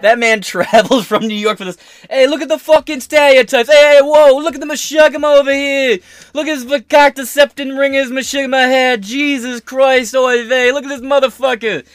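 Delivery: loud, high New York Yiddish shtick; mock Yiddish accent, yelling.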